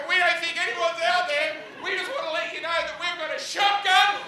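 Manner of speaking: high, loud, put-on nervous camper voice; mock-brave high voice talking into the dark; fake campers' nervous bluff. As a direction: play a scared camper bluffing about a shotgun. Prankster voicing fake campers' warning.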